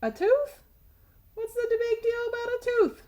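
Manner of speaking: high, skeptical "a tooth?" voice; lightly voiced doubting reader; incredulous listener. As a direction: mimic a doubtful kid's scoff. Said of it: "You're probably saying".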